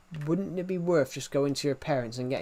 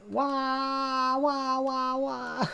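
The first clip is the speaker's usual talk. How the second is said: high, drawn-out mock wail; fake crying; 11 semitones higher, much slower than usual. Streamer showing off to viewers he can fake cry, mock wailing. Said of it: "very good at fake crying".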